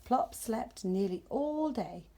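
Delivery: plain reading voice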